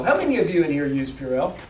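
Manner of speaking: ordinary talk, nothing marked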